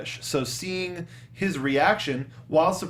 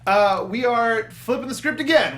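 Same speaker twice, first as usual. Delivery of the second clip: raised high and loud like a trailer voice; dramatic announcement; 8 semitones higher, 5 dB louder than usual. Acted. Host hamming up the segment switch, loud and high. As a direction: announce the twist with mock drama. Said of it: "get really dramatic there".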